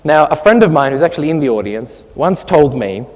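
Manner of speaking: ordinary talk, nothing marked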